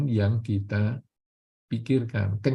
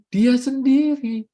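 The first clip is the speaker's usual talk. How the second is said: "Dia sendiri" slow, high and louder; emphatic stress; 10 semitones higher, 6 dB louder, slower than usual. Real, not acted. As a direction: lean hard on "Dia sendiri", drawn out. Preacher stresses who caused it.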